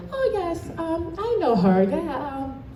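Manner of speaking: imitating a polite but empty reply; mock polite brush-off. Mock answer from unengaged adults.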